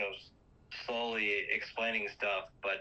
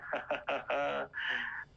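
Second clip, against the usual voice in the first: imitated gloating cackle; mock villain laugh; 4 semitones higher than usual. Villain taunts victims, retold.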